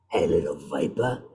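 put-on low villain voice in South African accent. Quoting his Apex character.

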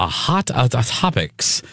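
slow, sinister villain drawl. Acted. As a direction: say it like a sneaky villain. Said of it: "you sounded like a villain".

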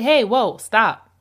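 loud, firm "Hey, whoa, stop."; loud, firm demo of a firm boundary. Shown as a demonstration. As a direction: put your foot down loudly. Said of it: "sometimes you have to say".

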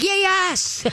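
high-pitched, thrilled cheer; gleeful high yelp of agreement; excited dog reply. Acted. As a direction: squeal it like an overjoyed dog. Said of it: "so they're like".

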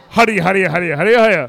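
loud, garbled Irish rant; hammed-up Irish babble, words blurred together; unintelligible Irish comeback. Imitating the spectator's comeback, aggressive garbled Irish. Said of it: "you couldn't understand him".

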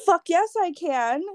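high-pitched cocky retort; defiant comeback. Retold or imitated. Retelling her answer to the cousin's challenge, defiant comeback. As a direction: fire back with indignant certainty.

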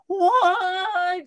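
high, long wailing "wah"; imitated engine surge. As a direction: wail like a gunned engine. Imitating the gassed truck.

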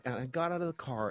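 usual speaking voice

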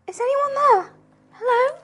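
high, tentative call from a cell; prisoner calling out. Acted. Prisoner calling to whoever is outside, high and questioning.

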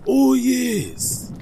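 high, loud eager Oh yes; bright eager agreement; excited. Acted. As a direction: burst in with delight. Ad skit, eager reply.

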